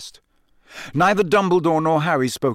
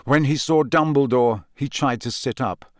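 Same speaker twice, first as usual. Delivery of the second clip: usual speaking voice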